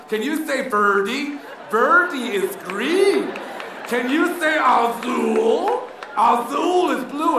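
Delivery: put-on sing-song teacher drilling kids; exaggerated slow teacher drill; Spanish teacher. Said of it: "that would be the Spanish teacher".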